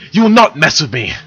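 a loud indignant huff; huffy. Acted. Silver huffing at Moltar, stomping her hoof, loud. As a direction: huff it firmly. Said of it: "Silver huffed".

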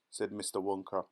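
plain reading voice

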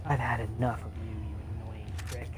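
normal talking voice

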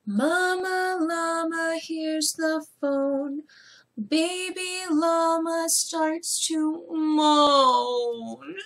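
lilting storybook reading; reading to children. Acted. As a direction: read it like bedtime story time. Reads the story aloud to children.

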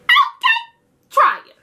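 loud, high mock dare; taunt. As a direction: dare them to try it. Taunting challenge.

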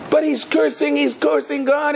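very high-pitched mock alarm; imitated outraged whine to a leader; scandalized protest. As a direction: play an accuser bursting in with an objection. Followers protest again to the rabbi.

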